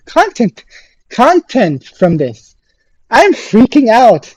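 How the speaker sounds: ordinary talk, nothing marked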